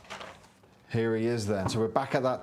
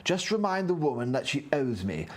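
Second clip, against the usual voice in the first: lightly voiced nerdy NPC, a bit higher; nerdy character reading his lines; nerd voice; 5 semitones higher than usual. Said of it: "do your nerd voice".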